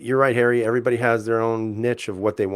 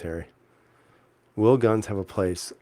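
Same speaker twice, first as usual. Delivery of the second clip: normal talking voice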